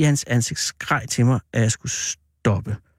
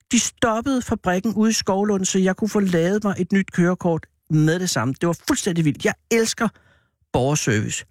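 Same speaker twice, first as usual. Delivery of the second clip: mildly hyped, higher-pitched chatter; excited enthusing; 6 semitones higher than usual. Acted. Man praising the licence office to a boy, mildly excited. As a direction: enthuse with the pitch lifted, dropping at the end.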